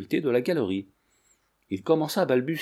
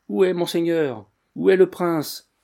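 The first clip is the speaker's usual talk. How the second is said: raised, higher searching cry; calling out; 6 semitones higher, 5 dB louder than usual. A narrator's reading. Servants searching the tunnels for their prince, calling out. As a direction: call out as if searching for a master. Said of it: "lesquels criaient".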